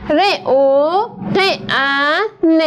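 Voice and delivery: ر او ق ان, each sound said on its own, very slowly. Shown as a demonstration. Teacher sounding out each letter for pupils, very slow. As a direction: spell out the sounds one by one. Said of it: "صدا کشیش کردم".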